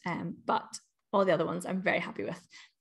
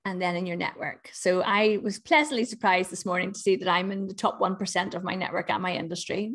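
ordinary talk

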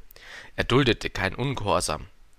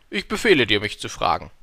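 eilfertiger Befehl; 5 dB louder than usual. A narrator's reading. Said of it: "beeilte der, sich der König zu sagen".

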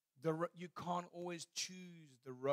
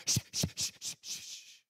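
loud shushing. Retold or imitated. Family frantically shushing Jemima in the dark garden, breathy shushing. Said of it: "we didn't help by going".

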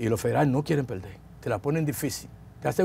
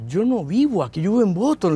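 higher-pitched, mildly pleading protest; retold protest; 5 semitones higher than usual. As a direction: insist they have the wrong man.

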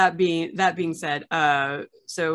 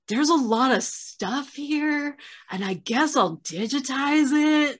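slow, higher, put-upon grumble; mock complaining archivist; 9 semitones higher, slower than usual. Archivist complaining into his tape, slow mock whine. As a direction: play the weary archivist moaning into a tape. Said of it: "just being like".

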